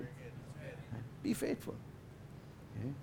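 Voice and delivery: hushed whisper of advice. Real, not acted. Preacher whispering to congregation to be faithful. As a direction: whisper it close. Whispered aside after exhortation.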